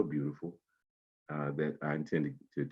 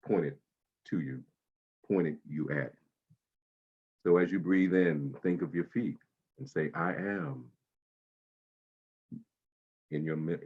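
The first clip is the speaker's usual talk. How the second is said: guided meditation. Acted. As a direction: ease them into calm breathing. Guides body-scan meditation.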